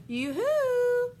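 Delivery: high sing-song yoo-hoo; calling out. Acted. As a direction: call out to birds far away. Santa calls out for flyers.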